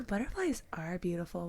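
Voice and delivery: put-on soft, encouraging reply to a toddler; soft auntie voice. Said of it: "I'm like".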